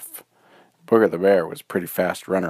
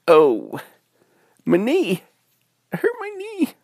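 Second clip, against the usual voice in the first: high, whiny mock cry; acted tearful squeal of pain; hurt wailing; 4 dB louder than usual. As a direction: wail like a child with a scraped knee. Girl falls and hurts knee.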